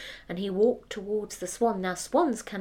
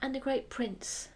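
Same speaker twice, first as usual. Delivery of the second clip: plain reading voice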